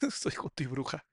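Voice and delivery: soft laughing echo of the line; laughing repeat. Real, not acted. Host echoing the co-host's joke, laughing softly.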